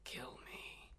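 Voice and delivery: whispered, breathless plea to be killed; rasping creature plea. Acted. Dying manticore whispering to the ranger, begging to be killed. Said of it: "it leans forward and goes".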